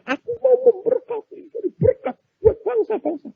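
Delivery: very high-pitched declaration, no extra volume; mock crying out. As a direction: cry out a grand vow, imitating eager believers. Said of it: "kita teriaknya". (pura-pura berseru dengan suara melengking)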